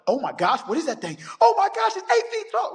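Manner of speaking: panicked caller. Retold or imitated. Caller spotting the creature during the 911 call, building panic. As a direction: build from alarm to full panic. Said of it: "losing it on the call".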